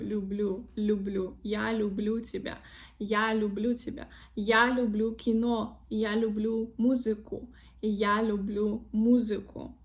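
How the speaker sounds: люблю with soft L, "lyublyu"; soft consonants. Shown as a demonstration. Teacher drilling correct examples, soft L in люблю. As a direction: say люблю softening each L before the U. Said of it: "L becomes softer".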